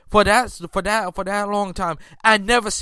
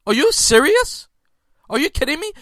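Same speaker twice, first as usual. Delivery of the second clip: incredulous; 6 semitones higher than usual. Real, not acted. Disbelief at the player.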